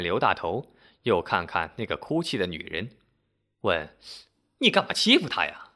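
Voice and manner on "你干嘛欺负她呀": high-pitched indignant challenge; scolding auntie. A narrator's reading. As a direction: take him to task in a raised pitch. Committee auntie scolds the repairman.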